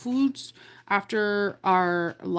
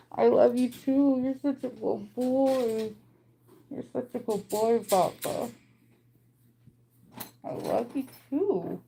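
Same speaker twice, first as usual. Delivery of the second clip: talking to a dog; 2 semitones higher, 3 dB quieter than usual. Real, not acted. Cooing to her dog Loki.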